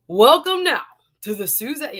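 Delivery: big booming ring announcer intro. Shown as a demonstration. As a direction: stretch it out like a fight announcer. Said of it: "Y'all ready for that kind of announcer voice?".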